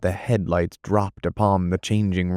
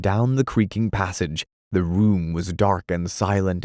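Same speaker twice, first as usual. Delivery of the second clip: ordinary delivery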